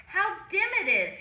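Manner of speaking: usual speaking voice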